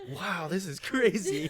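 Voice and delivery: high laughing wow; amazed through laughter; laughing amazement. Pretend. Pretending in heaven, laughing.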